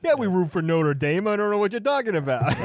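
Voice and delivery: high-pitched, hammed-up rural Pennsylvania accent; mock rural Pennsylvania accent. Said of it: "is that what, uh, folks in P A sound like".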